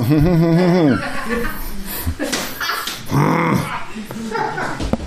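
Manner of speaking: long rising laugh; hearty laugh. Real, not acted. Preacher laughing at his wife's remark, long laugh. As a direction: laugh out at the joke.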